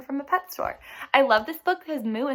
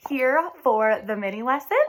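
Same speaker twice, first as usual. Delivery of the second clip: normal talking voice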